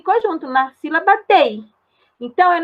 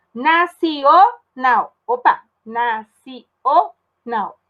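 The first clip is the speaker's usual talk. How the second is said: nacional drawn out slowly, ci and o apart; slow syllable split; much slower than usual. Shown as a demonstration. Teacher slowly splitting nacional for pupils. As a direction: stretch nacional to show the split. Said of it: "Já separou o nacional".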